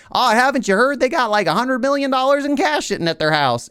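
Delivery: high-pitched, hammed-up Southern busybody; Southern accent. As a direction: spill town gossip in a put-on high Southern accent. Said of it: "I don't know if you really got a Southern accent like that".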